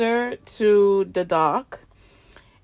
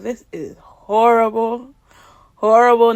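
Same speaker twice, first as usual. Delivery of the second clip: frustrated complaint; 4 dB louder than usual. Retold or imitated. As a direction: voice mild frustration, leaning on HORRIBLE. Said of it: "I'm vocalizing this".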